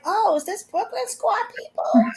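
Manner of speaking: light impression of an adorable kid; imitating a little girl. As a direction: pipe it up like a small child asking. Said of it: "She sounds so adorable".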